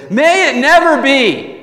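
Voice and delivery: high, loud emphatic outcry, drawn out; emphatic yell. Acted. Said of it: "He's, like, yelling here".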